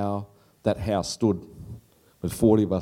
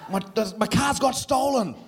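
mum's voice; 13 semitones higher than usual. Retold or imitated. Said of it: "She goes".

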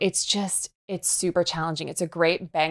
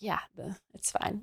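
much softer, offhand and breezy; casual understatement; 11 dB quieter than usual. Retold or imitated. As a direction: shrug it off quietly like it's nothing. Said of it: "I was like".

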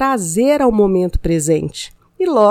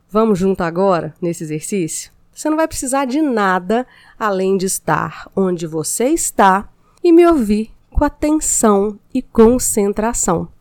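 ordinary talk, nothing marked

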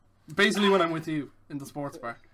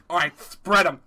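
lightly voiced Peter Griffin; a hint of Peter Griffin, loud; 7 dB louder, slower than usual. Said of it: "Doing a Peter Griffin im".

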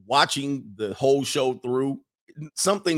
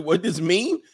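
higher-pitched imitation of a foreign girlfriend's accent; mock African-sounding accent; 6 semitones higher, 3 dB louder, slower than usual. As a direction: put on a rough African-ish accent, rising into a question.

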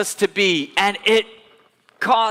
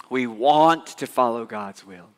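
ordinary talk, nothing marked

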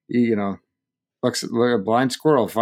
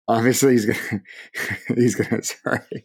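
laughing. Real, not acted. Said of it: "Couldn't stop laughing".

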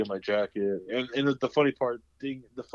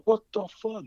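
shocked outburst; 11 semitones higher, 5 dB louder than usual. Retold or imitated. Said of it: "I was like".

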